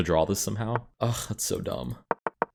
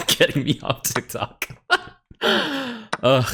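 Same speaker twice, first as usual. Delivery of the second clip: ordinary talk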